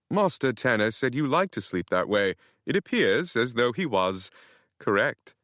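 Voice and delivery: hint of a cheeky British houseboy; sly British butler. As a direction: tease it politely in a British lilt. Nigel the houseboy speaking.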